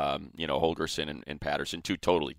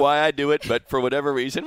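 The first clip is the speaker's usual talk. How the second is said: ordinary talk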